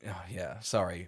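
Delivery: muffled, whispering into shirt; hushed words into a shirt; talking into sleeve. Real, not acted. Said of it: "I'm whispering into my shirt".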